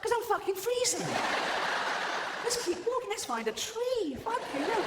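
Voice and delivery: very high, squealed protest; exasperated freezing migrant. Acted. Freezing Eskimo at the back objects to settling, exasperated. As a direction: complain in a comic high-pitched squeal.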